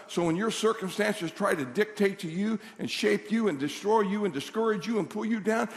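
ordinary delivery

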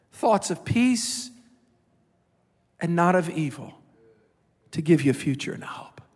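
tender hushed scripture; soft scripture reading. Real, not acted. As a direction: let the verse fall softly, almost a whisper at the end. Reading scripture softly to congregation.

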